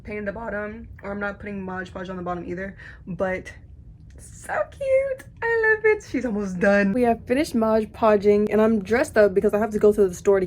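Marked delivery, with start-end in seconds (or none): 4.2-6.0 higher, sing-song admiration; cooing over something adorable; delighted coo. Real, not acted. Admiring her painted flower to viewers, cooing delightedly.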